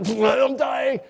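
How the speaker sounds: laughing through the words; breathless laugh. Retold or imitated. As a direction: crack up at the memory. Laughing about water up nose.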